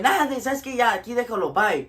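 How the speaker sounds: high-pitched dismissal dropping toward a curt bye; retold impatient sign-off in Spanish; fed-up brush-off. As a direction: cut the call short, exasperated. Fed up, ends the phone call.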